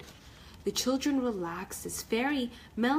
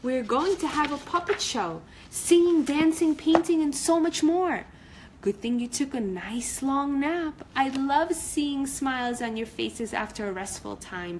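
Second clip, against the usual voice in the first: excited cheerful; 4 semitones higher, 4 dB louder than usual. Acted. Cory bird cheers children.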